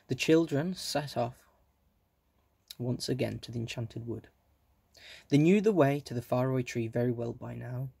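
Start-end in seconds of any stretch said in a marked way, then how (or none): none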